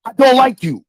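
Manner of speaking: usual speaking voice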